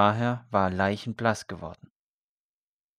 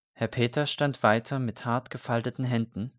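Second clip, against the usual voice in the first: plain reading voice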